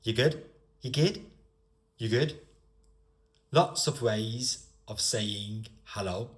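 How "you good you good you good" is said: regional British greeting, rising at the end; local-accent check-in. Shown as a demonstration. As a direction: ask it casually in a local accent. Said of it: "because how it's said".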